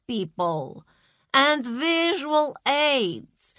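slow, condescending manager; brisk boss voice. A narrator's reading. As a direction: talk down like a boss. Masha lecturing.

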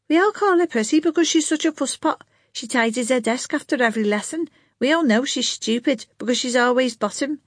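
high, scornful schoolgirl voice; a sulky girl telling tales; young girl voice. A narrator's reading. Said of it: "Josephine explains about Prissy".

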